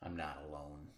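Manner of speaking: ordinary delivery, nothing marked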